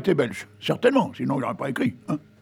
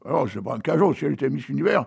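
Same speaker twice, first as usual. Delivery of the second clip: ordinary delivery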